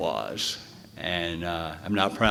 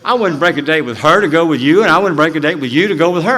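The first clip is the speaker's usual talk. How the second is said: emphatic boast; 6 semitones higher, 10 dB louder, faster than usual. Retold or imitated. His proud line to woman.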